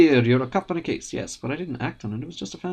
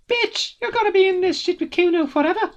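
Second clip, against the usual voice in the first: much higher, triumphant brat; gloating kid king; 5 dB louder than usual. Acted. Kuno spreading his arms over the yard, gloating.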